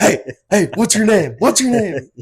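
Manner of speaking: high, loud yell after a fleeing driver. Retold or imitated. Yelling after the DoorDasher running to her car. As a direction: shout it down the street at her. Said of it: "And I'm yelling at her".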